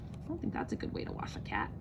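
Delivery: fast, breathless whisper to the listeners; hushed fast aside. Real, not acted. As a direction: murmur it quickly under your breath.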